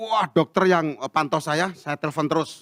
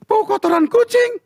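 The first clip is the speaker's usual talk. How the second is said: much higher, louder, slow disbelieving question; astonished; 5 dB louder than usual. Retold or imitated. Husband amazed his wife can smell cat droppings, high astonished. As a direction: stretch it out in amazement.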